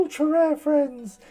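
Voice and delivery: ordinary talk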